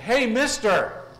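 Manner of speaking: loud, high parrot squawk. Acted. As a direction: yell it like a cheeky parrot. Parrot yells at customer in joke.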